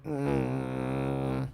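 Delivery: lowest possible closed-mouth hum; low techno bass hum. Shown as a demonstration. Teacher demos the techno bass to kids, low hum. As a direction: hit your bottom note, mouth shut. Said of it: "and you're going".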